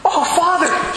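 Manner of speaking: light up at seeing a priest; excited cry. Retold or imitated. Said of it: "She goes".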